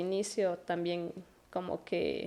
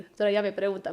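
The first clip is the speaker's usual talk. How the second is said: ordinary delivery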